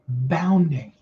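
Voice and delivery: normal talking voice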